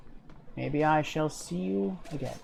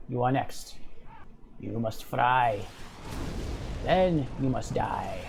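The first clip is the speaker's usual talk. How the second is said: deep gravelly pit fiend; 5 semitones lower, 4 dB quieter than usual. Acted. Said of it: "villain taunts after kill".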